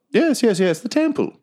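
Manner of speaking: a courteous creature introducing itself; polite badger. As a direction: badger introducing himself to Quinny, high and proper.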